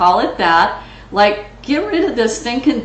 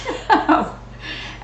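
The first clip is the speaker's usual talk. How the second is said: short laugh; 8 semitones higher, 4 dB quieter than usual. Real, not acted. Laughs about her hooting.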